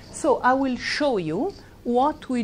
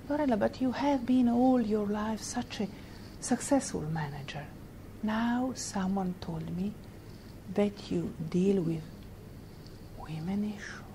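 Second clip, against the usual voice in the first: drops to a hush, softer and breathy toward a whisper on the last words; confidential hush; 7 dB quieter than usual. Retold or imitated. Old friend confides worry to Lorella, hushed whisper. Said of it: "he changed completely his face".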